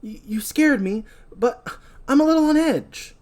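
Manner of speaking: put-on high, nervous girl apologising; flustered apology. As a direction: apologise in a jittery rush. Julie apologises to Will.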